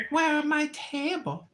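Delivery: high, whiny immigrant tenant voice; mock tenant voice. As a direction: whine like a fretful tenant. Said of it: "She's like".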